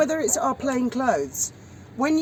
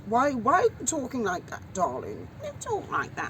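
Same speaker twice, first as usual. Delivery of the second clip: mock Queen's English, swooping pitch; parents' posh accent; 2 semitones higher, 4 dB quieter than usual. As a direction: imitate posh parents gently making fun. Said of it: "my parents would take, make fun of me".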